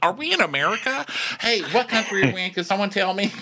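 high, quick, dopey voice; mock confused patriot. Diner asking what country this is, high dopey. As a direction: ask it dopey and fast. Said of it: "It's like".

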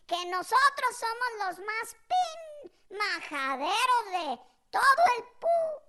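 high, drawn-out censored boasting; mock swearing aunt. Aunt claiming her title, hammed-up swearing. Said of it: "Así dijo, pero completo".

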